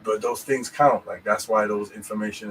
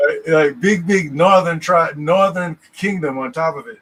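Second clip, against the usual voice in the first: excited; 6 semitones higher, 8 dB louder than usual. Real, not acted. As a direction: pile it on with glee. Excited mocking riff.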